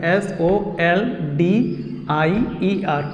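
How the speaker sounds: slow careful dictation, letter by letter; slow spelling. Shown as a demonstration. Teacher dictates soldier slowly so students can write it. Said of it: "teacher spelling soldier".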